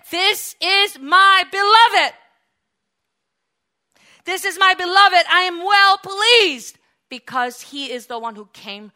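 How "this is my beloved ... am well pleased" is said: loud, high, slow divine proclamation; God announcing his beloved at the baptism; proclaiming voice of God. Acted. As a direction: proclaim it from heaven, slowly.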